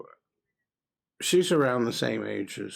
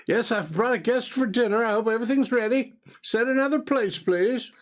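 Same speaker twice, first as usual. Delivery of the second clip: high, raised, formal husband's voice; calling to wife; 11 semitones higher, 3 dB louder than usual. Acted. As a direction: breezily hand out orders across the house. Husband calls to wife in kitchen.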